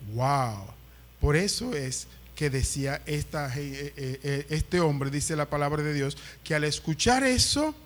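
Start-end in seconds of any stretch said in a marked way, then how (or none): none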